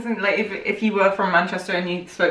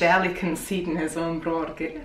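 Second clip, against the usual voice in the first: lower, put-on Scottish accent; 4 semitones lower, 6 dB quieter than usual. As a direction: read it low, as a Scot. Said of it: "Probably Scottish".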